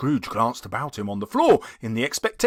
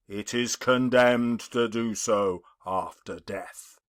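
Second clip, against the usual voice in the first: a dead man's warning, intoned; solemn ghost. Acted. The ghost speaks.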